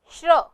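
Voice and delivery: "श्र" as श and र blended into one syllable; conjunct pronunciation. Shown as a demonstration. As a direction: say "श्र" on its own, श straight into र. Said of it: "इसका उच्चारण". ("श्र" में श और र मिलाकर उच्चारण)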